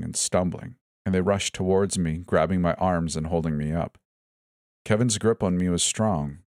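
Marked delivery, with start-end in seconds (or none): none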